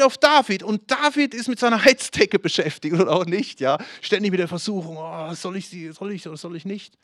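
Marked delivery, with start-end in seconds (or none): 5.0-7.0 a soft put-on voice, torn between yes and no; a hint of a tempted old king dithering; mock hesitation. Voicing David's temptation.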